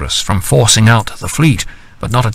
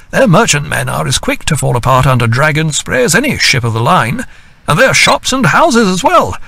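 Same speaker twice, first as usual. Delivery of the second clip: put-on higher, lively young captain's voice; Captain James; 7 semitones higher than usual. A narrator's reading. Captain James relishing the news from Cadiz to the captains.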